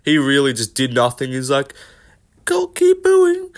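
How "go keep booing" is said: mock near-tears. Mocking Ian Garry getting booed, whiny near-tears. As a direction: squeak it out like you're about to cry. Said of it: "sounded like he was about to burst out crying".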